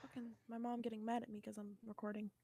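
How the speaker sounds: low, very quiet, fast mutter off-mic; frustrated aside. Real, not acted. The actor, annoyed that her mom is mad, muttering off-mic. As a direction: grumble it away from the mic.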